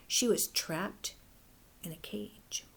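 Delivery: dropped to a breathy whisper; whispered dread of the trap; hushed reveal. A narrator's reading. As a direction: whisper it like a grim secret. Dramatic trap reveal in story.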